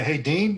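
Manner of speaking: "Dean" swung upward at the end; rising intonation on the name. Shown as a demonstration. Coach shows how to open a cold call, rising intonation. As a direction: familiar hail, pitch climbing on "Dean". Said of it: "you always go up on the name".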